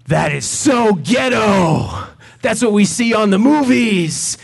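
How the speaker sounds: loud and high, with a slow drawn start; hammed-up excited teens shouting; loud gawking teens. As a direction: kids yelling as they jump off the bus. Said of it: "they're saying it really loud".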